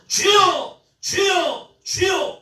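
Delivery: high, loud congregational prayer shout; forceful, high cry to the Lord. Retold or imitated. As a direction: imitate a church bursting into loud prayer. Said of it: "외치는 거예요".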